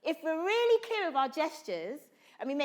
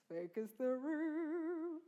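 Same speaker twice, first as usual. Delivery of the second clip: slow, much softer focusing call; slow hush; 9 dB quieter, slower than usual. Shown as a demonstration. Teacher hushing the class before performance, slow and soft. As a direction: draw the room into silence. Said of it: "repeated call".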